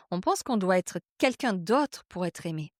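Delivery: ordinary talk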